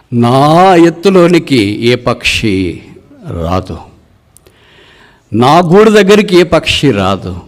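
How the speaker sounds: high, emphatic boast with long pauses; proud eagle. Acted. Eagle tells hunter of its height, proud high declamation. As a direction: declare it grandly, voice lifted high. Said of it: "పక్షిరాజు నోరు తెరిచి ఇలాగ చెప్పడం ఆరంభించింది".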